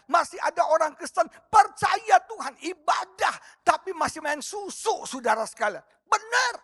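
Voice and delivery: high, forceful sermon list; fervent preaching. Real, not acted. Preacher exposing Christians who keep shamans, fervent preaching. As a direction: press each charge on the congregation.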